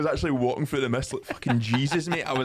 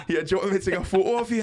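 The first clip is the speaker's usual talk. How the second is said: mock polite stranger; 7 semitones higher, 3 dB louder, faster than usual. Couple offering photo, mocked.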